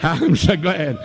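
normal talking voice

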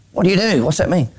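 ordinary delivery

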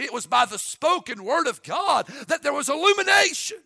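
shouted, high-pitched pulpit proclamation; fiery preaching. Acted. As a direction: boom it out to the congregation. Preacher opens at full force.

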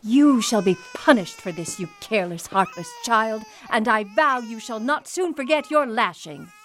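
indignant, menacing rebuke; stern threat. Acted. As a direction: pronounce punishment with cold anger. Mistress threatens punishment.

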